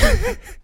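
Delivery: a sudden high laugh breaking out; burst of laughter. Real, not acted. Laughing at the guy's bad luck.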